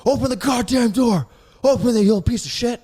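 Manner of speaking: loud, high furious yell; imitating grandpa's furious scream. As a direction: bang on the door and bellow. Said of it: "he's screaming out there at the front door".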